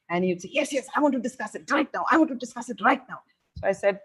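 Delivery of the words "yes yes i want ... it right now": put-on higher, fast, insistent teenage demand; impatient teen son. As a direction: mimic a pushy son who wants it now. Mother retells son's impatient demand.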